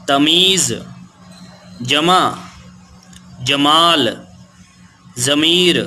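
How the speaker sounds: put-on each word said out slowly and clearly for children; teacher pronouncing vocabulary slowly for young learners; clear word drill. Shown as a demonstration. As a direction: read out the word list one by one, like a teacher. Reading out a word list.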